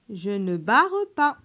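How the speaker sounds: ordinary delivery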